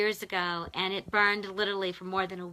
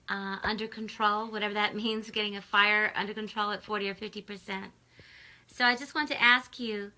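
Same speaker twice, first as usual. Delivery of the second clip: ordinary delivery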